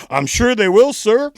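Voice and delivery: high, loud clerk voice rushing him out; a polite clerk wanting him gone; nervous clerk. Retold or imitated. Clerk hurrying the scary customer out, high and loud. Clerk quoted, sarcastic reply.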